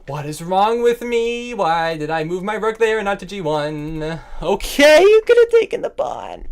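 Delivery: high, loud exasperated rant at himself; frustrated self-scolding. Real, not acted. Chess streamer berating himself after a blunder, high loud rant.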